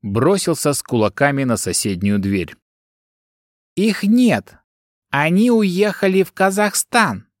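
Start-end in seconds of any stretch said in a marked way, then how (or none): 3.8-7.3 put-on high, quavering old woman's voice. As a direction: quaver it like a frail granny. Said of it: "дребезжащим голосом проговорила старушка".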